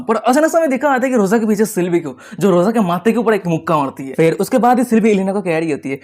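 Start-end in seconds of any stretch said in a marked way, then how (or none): none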